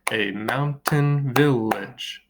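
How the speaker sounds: A mountain village split a-moun-tain-vil-lage; A mountain village in five separated beats; syllable by syllable. Shown as a demonstration. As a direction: say A mountain village slowly, one beat per syllable. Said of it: "Five syllables".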